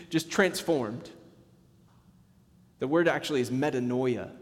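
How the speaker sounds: ordinary talk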